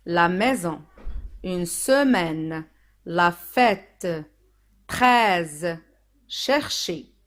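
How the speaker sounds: slow, spaced word list; reading out words. Shown as a demonstration. Teacher reading the word sheet for learners, slow and deliberate. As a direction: sound out each word with lengthened vowels. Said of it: "Je lis les mots".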